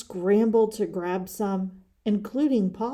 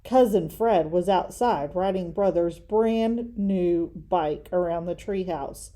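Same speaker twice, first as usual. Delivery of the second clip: usual speaking voice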